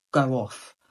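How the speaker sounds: ordinary talk, nothing marked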